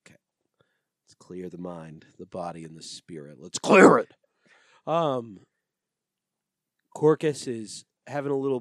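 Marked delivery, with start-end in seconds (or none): 0.0-3.4 slow, hushed guru whisper; calm everyone down like a meditation guide; mock meditation whisper. Mock calming reset. 3.4-4.1 loud sudden bark of the line; mock shout. Sudden shout after whisper.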